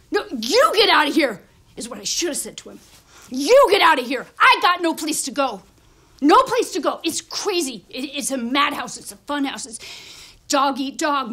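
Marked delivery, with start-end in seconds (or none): 0.1-1.4 loud comeback, hard on "you"; forceful, indignant counter-order; shouted retort. Acted. His imagined retort to the guy. 3.3-7.0 loud, indignant protest; heated yell, standing your ground; shouted retort. Acted. Repeats his retort, acted quarrel.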